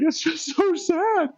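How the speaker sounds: high, wailing sob through the words; mock sobbing. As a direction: blubber it out like a baby over a TV ad. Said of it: "I'm just crying".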